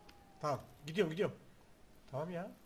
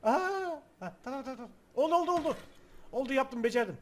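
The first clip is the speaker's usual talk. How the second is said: startled excitement; 5 semitones higher, 7 dB louder than usual. Real, not acted. Startled then triumphant gamer.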